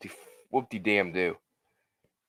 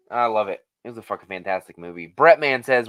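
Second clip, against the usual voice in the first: ordinary talk, nothing marked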